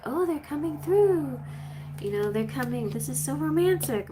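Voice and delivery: high, gushing mock excitement; imitating an excited reader. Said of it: "she was all excited about it".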